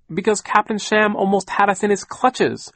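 high, loud urgent outcry; crying out. A narrator's reading. Niece telling aunt of their narrow escape, crying out. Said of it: "Violet cried".

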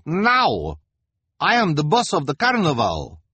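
put-on high, loud bossy accent; Madame Lulu commanding. A narrator's reading. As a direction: demand obedience as the boss.

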